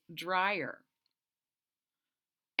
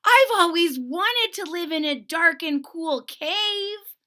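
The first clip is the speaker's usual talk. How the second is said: a small bear marveling at a cave; awed little bear; 8 semitones higher, 9 dB louder than usual. Acted. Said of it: "he said".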